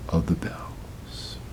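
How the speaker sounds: slow, breathless whisper to close the poem; hushed whisper as the bells fall silent; whispered ending. Acted. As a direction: let the last words die away in a whisper. Poem's final fading line.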